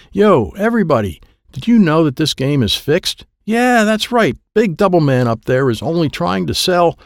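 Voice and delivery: high, projected public accusation; calling to crowd. Acted. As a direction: announce the cheat to everybody. Dad addresses the whole crowd.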